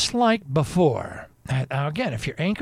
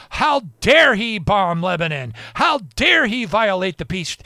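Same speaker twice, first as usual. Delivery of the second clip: mock outrage; 6 semitones higher, 7 dB louder than usual. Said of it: "pretends like he's angry".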